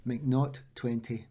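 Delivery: ordinary talk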